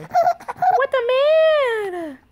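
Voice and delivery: high-pitched, playful outburst; playful exclamation. Real, not acted. Mom exclaims over her grown-acting baby, playful.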